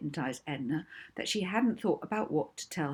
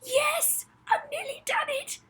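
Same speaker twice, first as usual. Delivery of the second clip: excited shout. Acted. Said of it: "so excited that she wanted to shout".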